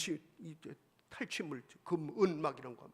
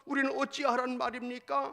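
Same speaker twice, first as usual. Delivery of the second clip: mock weeping plea; 6 semitones higher than usual. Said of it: "막 울면서 그러는 거예요".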